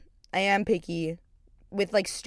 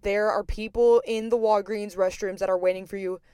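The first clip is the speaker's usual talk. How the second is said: usual speaking voice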